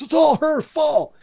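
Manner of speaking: high, loud imitation of an accuser; accusing. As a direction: point the finger in a high, exaggerated outburst. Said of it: "Eric starts blaming Terry".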